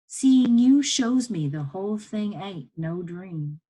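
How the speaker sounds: lightly voiced Southern woman, drawn out and emphatic; slow, heartfelt emphasis from an older comforter; moved, reassuring woman. Woman telling boy what seeing him means, moved.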